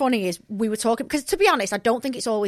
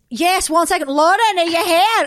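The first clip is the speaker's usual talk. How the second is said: louder, higher put-on Irish accent; mock Irish accent; 7 semitones higher, 7 dB louder than usual. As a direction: call out to Lauren in Irish. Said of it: "I was like".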